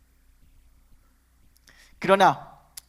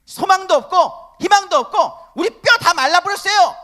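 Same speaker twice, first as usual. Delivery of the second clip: acting out Israel's despairing cry, higher and louder; despairing lament; 10 semitones higher, 10 dB louder than usual. Preacher voicing Israel's hopeless complaint to God, a despairing high cry. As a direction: wail it like a people who have given up hope. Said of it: "하나님 앞에 그렇게 얘기하고".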